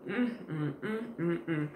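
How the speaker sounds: soft savoring mm; savoring hum. Real, not acted. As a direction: hum with pleasure over food. Tasting food, eating.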